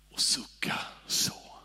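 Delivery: whispered, soft sigh. Shown as a demonstration. Preacher showing a gentle sigh to the congregation, whispered. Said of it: "Det finns en skillnad på".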